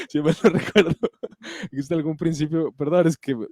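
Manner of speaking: apologetic, laughter-filled voice; laughing. Real, not acted. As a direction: fight real laughter while reading on. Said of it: "me da risa".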